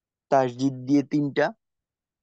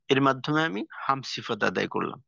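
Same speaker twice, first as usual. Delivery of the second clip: normal talking voice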